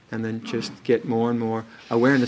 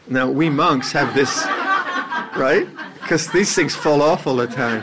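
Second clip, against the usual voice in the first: laughing; 3 semitones higher, 4 dB louder than usual. Real, not acted. Laughing at mom's monk imitation.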